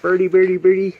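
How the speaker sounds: high, loud coaxing call; calling a bird. Real, not acted.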